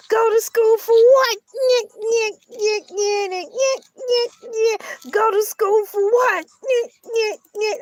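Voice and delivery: high, squeaky playground taunt; mocking taunt. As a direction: jeer in a silly high-pitched voice. Taunting chant mocking school.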